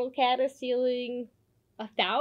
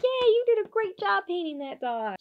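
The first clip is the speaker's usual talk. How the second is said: high, bright little toy cheering; squeaky plush-toy praise; cheery toy voice; 9 semitones higher, 3 dB louder than usual. Acted.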